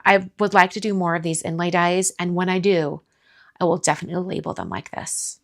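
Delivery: ordinary delivery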